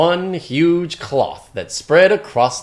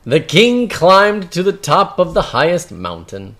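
ordinary delivery, nothing marked

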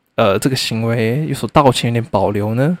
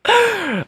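squealing laugh, much higher; high burst of laughter; 4 dB quieter than usual. Real, not acted. Laughing at his own rant, high giggle. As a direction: break into a shrill giggle.

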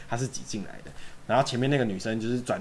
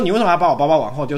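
mock angry; 4 semitones higher, 8 dB louder than usual. Guy angrily scolding.

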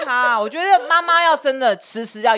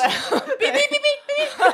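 high, slow laughing beeps; censor beep imitation; 3 dB louder, much slower than usual. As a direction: bleep it out like a censor beep, giggling. Mock censoring the doctor remark.

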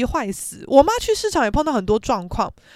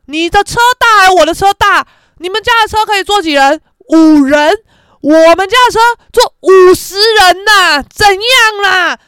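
loud, high one-upping challenge; hammed-up cocky shout; mock boastful shouting; 7 semitones higher, 11 dB louder than usual. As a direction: brag at full volume to win the contest. Acted boastful car-size argument.

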